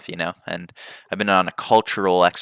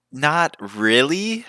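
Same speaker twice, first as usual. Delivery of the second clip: slow teasing reply; 8 semitones higher, much slower than usual. Retold or imitated. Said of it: "he was like".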